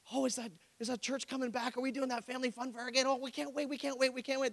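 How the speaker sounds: high, soft, eager chatter; eager neighbors. Pretend. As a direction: gush like excited neighbors. Neighbors quoted eagerly anticipating.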